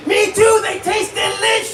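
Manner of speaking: high, exuberant punchline yell; gleeful shout. Pretend. Comic answering who loves babies, gleeful shout. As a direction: burst out with mock delight.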